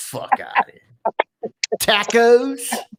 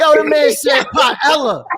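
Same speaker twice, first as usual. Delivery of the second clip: outraged shout; 4 semitones higher, 4 dB louder than usual. Real, not acted. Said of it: "outraged rant at the song".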